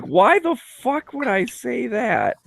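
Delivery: mock self-exasperation; exasperated at himself. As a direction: stretch the disbelief.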